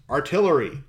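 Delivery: loud, high battlefield shout; command over roars. A narrator's reading. Officer calling in cannons over a dragon's roars, shouted command. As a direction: yell the order over the din. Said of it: "He heard another command over the roars".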